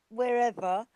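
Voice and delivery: normal talking voice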